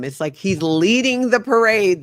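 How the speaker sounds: normal talking voice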